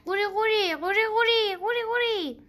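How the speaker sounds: high croaking calls, repeated; high croaks again and again; repeated frog croak. A narrator's reading. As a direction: croak three times like a frog. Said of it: "سه بار تکرار کرد".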